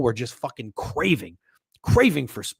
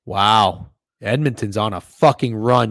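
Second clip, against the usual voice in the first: usual speaking voice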